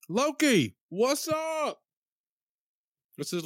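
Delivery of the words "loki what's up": excited greeting. Real, not acted. Greeting a chat viewer loudly.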